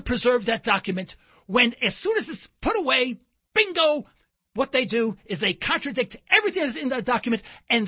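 loud, outraged, building on each clause; indignant anger. Retold or imitated. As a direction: rail against the hypocrisy. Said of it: "Because I was angry".

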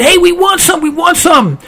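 high-pitched, eager demand; high, pleading story voice; clamouring animals. Acted. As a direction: pipe up like a greedy little crowd.

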